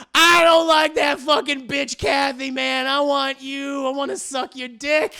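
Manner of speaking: loud, high speakerphone outburst; shouted rant. Retold or imitated. Chuck unknowingly on speaker to the wife, shouted rant. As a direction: blurt it out at full volume. Caller rants over speakerphone.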